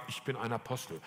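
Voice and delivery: low, soft, reluctant admission; understated, hesitant confession; reluctant practice. Retold or imitated. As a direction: say it timidly, as if it is hard to say. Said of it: "Das ging so schwer".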